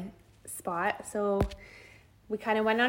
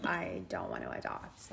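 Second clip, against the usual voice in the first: flat refusal; 11 dB quieter than usual. Retold or imitated. Imitating husband's refusal.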